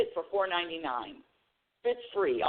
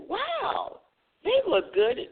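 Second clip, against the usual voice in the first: impressed wow; much slower than usual. Retold or imitated. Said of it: "I said".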